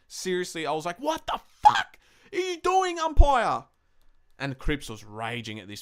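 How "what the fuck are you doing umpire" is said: much higher, louder furious yell; retold yell at umpire. Fan reliving yelling at the umpire from the couch, higher and louder yell. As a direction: rage at the ump from the couch. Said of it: "because I screamed that loud".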